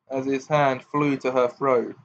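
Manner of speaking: plain reading voice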